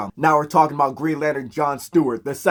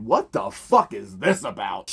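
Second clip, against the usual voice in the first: cold anger; 6 semitones lower, slower than usual. Retold or imitated. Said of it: "She said ... decked that man".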